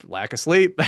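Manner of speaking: ordinary delivery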